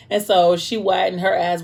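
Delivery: ordinary delivery, nothing marked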